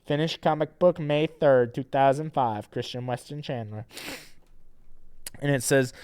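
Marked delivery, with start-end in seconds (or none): none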